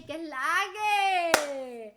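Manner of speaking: loud, long drawn-out call; big, slow Puerto Rican holler; hyped greeting. Shown as a demonstration. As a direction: stretch it out like hailing a friend.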